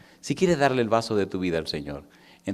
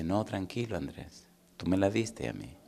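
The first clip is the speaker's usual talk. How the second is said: much softer, hushed reply; soft voice of the Lord; 7 dB quieter than usual. Retold or imitated. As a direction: speak it gently as the Lord. The Lord answering the preacher. (voz del Señor, suave y baja)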